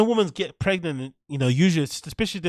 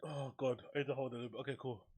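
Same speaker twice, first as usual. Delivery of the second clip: strained, breath held; holding breath; 19 dB quieter, faster than usual. Real, not acted. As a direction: speak while holding breath. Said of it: "hold my breath".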